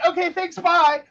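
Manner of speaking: a hint of a happy husband hanging up quickly; breezy husband. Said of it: "And he's like".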